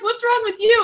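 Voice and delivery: high-pitched retold disbelief; mock appalled. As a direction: squeak it at a kid, playfully aghast. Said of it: "And I'm like".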